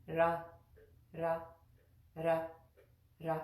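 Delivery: "Ra" with tongue just behind the upper teeth; tongue-tip ra. Shown as a demonstration. Teacher drilling the r sound. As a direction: tap "Ra" lightly, near a rolled r. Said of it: "practice that with me".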